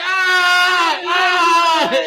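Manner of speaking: high, loud mock scream. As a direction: scream like a terrified woman. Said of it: "She's like".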